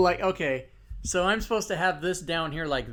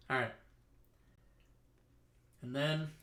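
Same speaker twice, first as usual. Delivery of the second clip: ordinary delivery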